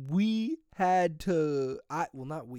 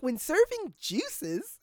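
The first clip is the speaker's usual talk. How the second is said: mock trainer voice; 10 semitones higher, slower than usual. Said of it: "He's like".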